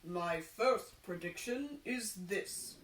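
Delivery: fortune teller voice. Acted. Fortune teller to the carpenter, slow mystic intoning. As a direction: intone it like a solemn prophet.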